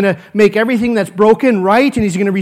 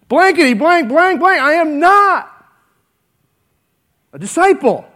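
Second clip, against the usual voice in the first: higher, hammed-up swearing denial; a preacher standing in for Peter's curses, pitched up; mock cursing; 6 semitones higher than usual.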